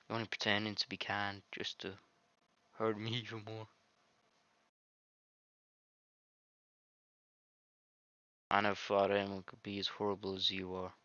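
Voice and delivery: put-on quiet, wounded girl; softly read heartbroken heroine. The deleted heroine mourning to the player, soft and hurt. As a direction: let her plead quietly, hurt. Reads heroine's accusing lines.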